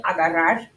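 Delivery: agarrar with a slight breathy puff at the end; aspirated final R. Shown as a demonstration. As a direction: let a little breath out after agarrar. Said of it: "can you hear the little breath".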